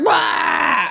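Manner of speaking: high-pitched yell of a whole crowd; mock crowd roar. Comic mimics everyone at the corporate gig, high mock roar. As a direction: imitate an audience erupting in one big shout. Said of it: "and everyone's like".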